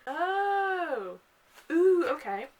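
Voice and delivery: high, sudden oh of realization; surprised realization. Real, not acted. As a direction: catch yourself mid-thought, surprised. Realizes she misremembered the plot.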